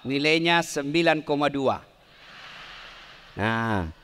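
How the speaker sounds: normal talking voice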